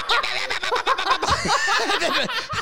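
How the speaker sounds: high-pitched frenzied babble; manic gibbering. Acted. Broker celebrating the comeback, gibbering manically. Acted salesman's wordless frenzy.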